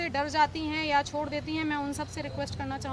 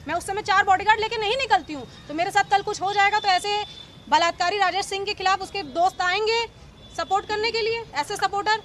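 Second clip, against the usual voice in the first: loud, higher, furious protest; rising outrage as she lashes out at his friends; real anger at a press interview; indignant outburst; 4 semitones higher, 5 dB louder than usual. Real, not acted. Outraged woman denouncing her harasser.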